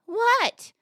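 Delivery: high-pitched questioning lady. Acted. Lady answering a parrot on a perch, high lady voice. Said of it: "The lady says".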